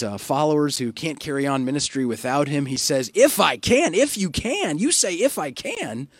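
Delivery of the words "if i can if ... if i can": higher, sharp mock echo of the father's words; irritated retort. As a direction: throw his doubt back at him. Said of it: "maybe he's just in a mood".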